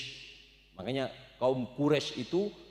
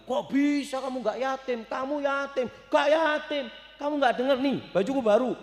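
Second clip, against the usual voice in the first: put-on child's voice; 10 semitones higher than usual. Children talking in the story.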